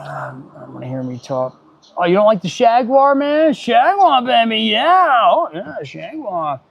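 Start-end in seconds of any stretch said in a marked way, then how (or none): 2.0-3.5 high, loud playful hype; loud, high-pitched teasing brag; hyped up. Pretend. Hyping up his Jaguar in-game. 3.5-5.8 Jaguar sung out high and loud, bragging; loud, high playful boast on Jaguar; hyped up. Pretend. Hyping up his Jaguar in-game.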